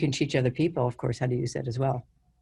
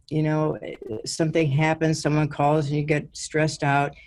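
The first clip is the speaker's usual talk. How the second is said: ordinary talk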